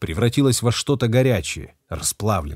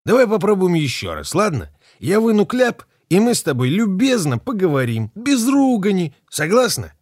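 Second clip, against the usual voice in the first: higher, louder coaxing voice; coaxing captor; 9 semitones higher, 4 dB louder than usual. A narrator's reading. Captor Kella coaxing the bound captain to talk nicely. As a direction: wheedle her into agreeing.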